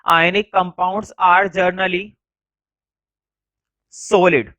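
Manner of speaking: word by word, very slow, with long pauses; slow classroom dictation. Shown as a demonstration. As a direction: dictate each word so the class can write it down. Teacher dictating notes slowly.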